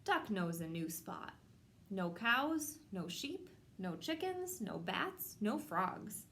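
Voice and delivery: ordinary delivery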